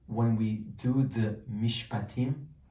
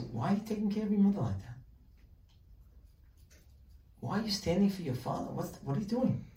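higher, fast, puzzled questioning; quizzing rabbi; 8 semitones higher, faster than usual. Retold or imitated. Rabbi quizzing a son caring for parents, fast and higher. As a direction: rattle off the baffled questions.

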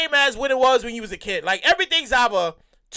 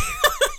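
short squealing giggle; high squeal laugh. Real, not acted. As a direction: cackle at the joke. Laughs again.

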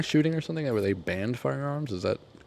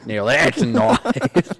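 slow, exaggerated Australian catchphrase; Australian accent; 3 semitones higher, 4 dB louder, much slower than usual. Host copying guest's knife line, drawn-out mock Australian accent. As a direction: drawl it as a hammed-up Australian. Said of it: "Australian accent".

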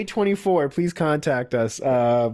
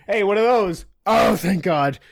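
acted relief; 4 semitones higher, 3 dB louder than usual. Acting out someone noticing the shoes.

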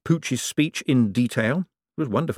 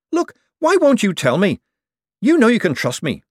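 insistent; 11 semitones higher, 5 dB louder than usual. Acted. Adora pleads with Moist.

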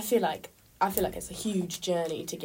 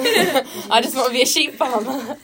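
high, loud, laughing outburst; real laughter bursting through the words; 6 semitones higher, 10 dB louder than usual. Real, not acted. Laughing admission of a dream.

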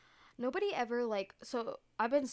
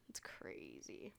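breathy whispered aside, much softer; 14 dB quieter, much slower than usual. Real, not acted. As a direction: drop to a hushed whisper in awe. Repeated "it's crazy", whispered.

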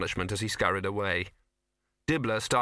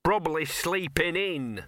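higher, slow, sulky dog; sullen grumbling; 7 semitones higher, slower than usual. A narrator's reading. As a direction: grumble it from down among the legs. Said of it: "grumbled a sullen and totally ignored voice".

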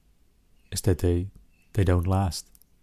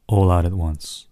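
normal talking voice

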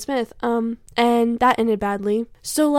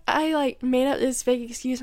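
usual speaking voice

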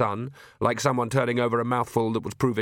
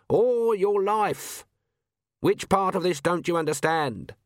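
much higher, slow, exasperated robber; swaggering gentleman highwayman; 6 semitones higher, slower than usual. Acted. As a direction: spell it out to a fool. Highwayman repeats.

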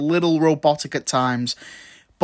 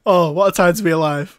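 ordinary talk

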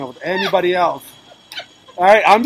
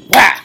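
sudden loud "wah!" sound effect; imitated smack noise; 3 semitones higher, 9 dB louder than usual.

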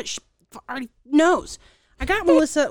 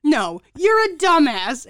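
slow, louder playful insult; mock scolding; 5 semitones higher, 7 dB louder, slower than usual. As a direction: scold the sister, laughing inside. Retold scolding of sister.

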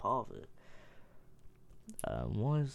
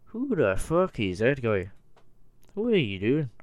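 loud, high-pitched Irish accent; Conor McGregor; 4 semitones higher, 10 dB louder than usual. Shown as a demonstration. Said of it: "I can do a Conor McGregor".

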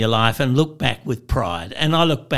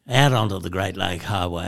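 ordinary delivery

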